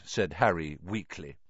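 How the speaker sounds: ordinary delivery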